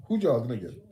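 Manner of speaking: shouted catchphrase imitation. Fan repeating a favourite funny line, loud imitated shout. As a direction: call out the quoted catchphrase, amused.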